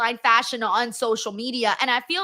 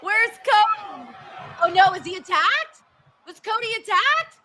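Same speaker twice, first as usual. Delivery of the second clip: alarmed high shouting; 6 semitones higher than usual. Real, not acted. Streamer alarmed Cody missing.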